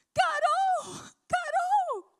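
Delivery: high, loud overjoyed calling of a name; excited cry. Retold or imitated. Preacher to the mother, loud and overjoyed. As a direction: shriek the name in delighted disbelief.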